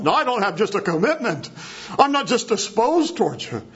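high, insistent acted denial; emphatic protest. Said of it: "he's putting that above just the agapao".

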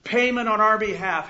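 loud, slow, emphatic sermon voice; preaching. Acted. Preacher proclaiming Christ as substitute, slow forceful preaching. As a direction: hammer out each phrase to the congregation.

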